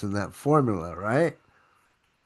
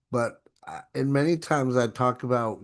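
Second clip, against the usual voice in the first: normal talking voice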